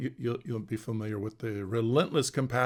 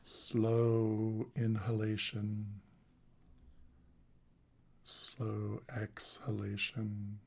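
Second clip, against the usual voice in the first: guided meditation. Acted. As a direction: guide them into the body, calmly and unhurried.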